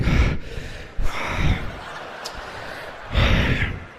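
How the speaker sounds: pilot's heavy panting sigh; breathless exhale. Retold or imitated. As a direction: huff out like a shaken pilot. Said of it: "he's totally out of breath".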